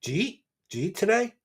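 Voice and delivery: "Jeet today" rising, "Jeet" one short syllable; Philly "Jeet" asked lightly. Shown as a demonstration. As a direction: ask "Jeet today" casually. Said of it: "We shorten it to Jeet".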